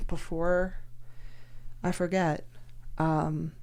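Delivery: softly, tiptoeing into bad news; a hint of nervous gentleness; imitating a careful partner asking quietly; cautious soft ask. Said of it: "You came up real cute and you were like".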